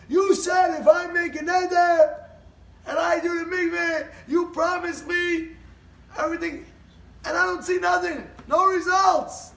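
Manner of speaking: indignant high-pitched holler; outraged complaint. Retold or imitated. Husband angrily confronts the Rebbe.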